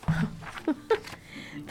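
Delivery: breathy, voiceless chuckle; amused chuckle. Real, not acted. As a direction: chuckle at your own joke. Laughs at own joke about eight seconds.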